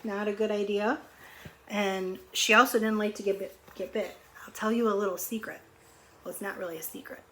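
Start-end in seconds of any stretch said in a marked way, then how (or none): none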